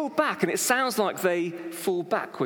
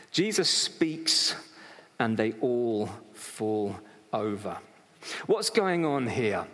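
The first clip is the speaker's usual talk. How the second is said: ordinary delivery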